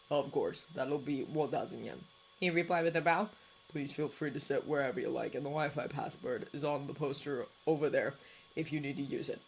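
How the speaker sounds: low, soft and level; Shota's low voice. A narrator's reading. Cafe owner greeting tourists, low and soft. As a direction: keep it low and quiet, a tired cafe owner.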